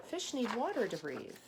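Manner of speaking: ordinary delivery, nothing marked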